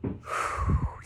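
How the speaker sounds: big audible breath, breathy and long; deep breath. Shown as a demonstration. Teacher demonstrating a deep breath for the class, breathy. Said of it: "One more".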